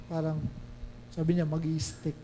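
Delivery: ordinary talk, nothing marked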